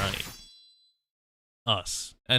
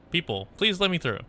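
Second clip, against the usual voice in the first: high, louder worried questions; worried soldier rushing in, raised and high; anxious operator; 7 semitones higher, 4 dB louder than usual. Acted. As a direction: burst in alarmed, pitched up. Voices character arriving on scene.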